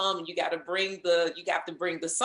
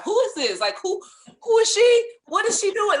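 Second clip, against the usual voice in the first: higher and louder, mock-curious gossiping voices; nosy onlookers; 10 dB louder than usual. Guest mimics office staff peeking in, pitched-up nosy gossip. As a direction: play staff peeking in, dying to know. Imitating coworkers peeping into room.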